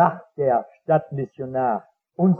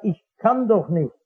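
ordinary talk, nothing marked

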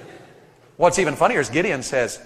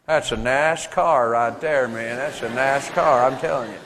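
slow, lower Southern drawl over the car; lazy Southern accent praising a car; 5 semitones lower, slower than usual. Retold or imitated. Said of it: "where they get nascar from".

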